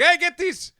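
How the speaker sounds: high, loud alarm call; drawn-out attacking yell; soldier's shout. Acted. North Korean soldiers coming out of the tree line, shouted.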